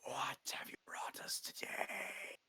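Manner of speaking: whispered creature rasp, very quiet; soft gravelly whisper from a hooded creature. Acted. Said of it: "it's very soft and kind of gravelly".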